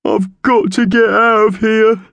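very high, loud wailing bird voice; sobbing. A narrator's reading. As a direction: wail it like a sobbing magpie. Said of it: "he began to sob".

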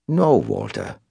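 put-on European accent, matter-of-fact denial; flat European contradiction. A narrator's reading. Caller corrects Cobb, flat European accent. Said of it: "the European just as flatly contradicted".

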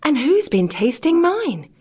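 middling-sized bear voice. A narrator's reading. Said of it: "in a middling-sized voice".

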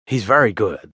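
usual speaking voice

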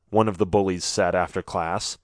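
ordinary delivery

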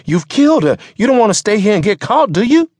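high, loud, rushed pleading; frantic urging. Acted. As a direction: press the driver hard, in alarm. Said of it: "Mister Barron urged".

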